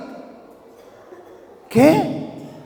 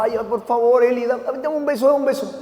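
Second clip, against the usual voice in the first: soft, coaxing dad voice; acted wheedling for a little kiss, hushed; tender pleading; 6 dB quieter than usual. Padre ruega beso a hija. (súplica tierna y suave de papá)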